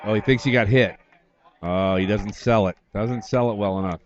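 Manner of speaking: normal talking voice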